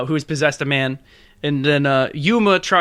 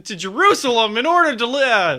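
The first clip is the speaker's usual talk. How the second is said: game show host; 7 semitones higher than usual. Pretend. Quiz host question.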